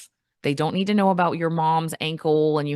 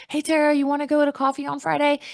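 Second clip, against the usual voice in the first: friendly invite; 11 semitones higher than usual. Retold or imitated. Friend invites her to coffee.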